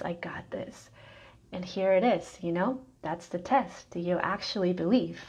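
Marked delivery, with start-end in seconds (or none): 0.0-0.9 soft whispered self-assurance; whispered affirmation. Pretend. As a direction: whisper it like a secret promise to yourself. Heart's inner voice affirming.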